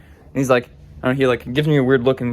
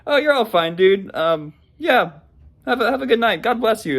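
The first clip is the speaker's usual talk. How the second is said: reassuring; 8 semitones higher than usual. Retold or imitated. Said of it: "I just said".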